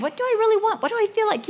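wondering aloud in a high voice what she wants; high inner voice. Retold or imitated. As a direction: ask yourself in a high, fretting voice. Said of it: "in our mind".

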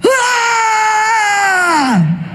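high, sudden shriek of dismay; shocked scream. Retold or imitated. Grandson opening the jacket at the party, high shocked shriek. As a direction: let out a high startled cry. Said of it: "and he goes".

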